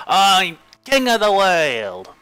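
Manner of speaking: high, loud, drawn-out cry; triumphant shout. Acted. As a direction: shout it out to the whole world. Acted film line, triumphant cry.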